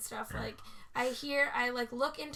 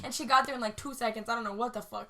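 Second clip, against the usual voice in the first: ordinary talk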